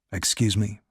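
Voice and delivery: low, soft polite excuse; Ricard's voice. A narrator's reading. Ricard slipping out of the lift, low and quiet.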